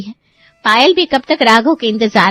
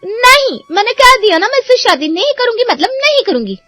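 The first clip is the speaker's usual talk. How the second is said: stubborn refusal; 10 semitones higher than usual. Acted. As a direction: dig in your heels and refuse, pitched high. Sister refuses, walking off. (ज़िद में ऊँची आवाज़ से इनकार)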